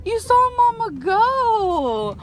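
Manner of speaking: high, drawn-out cooing. Real, not acted. Mama reassures the dog who saw her go, cooing. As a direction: stretch it out, cooing to a fretting dog.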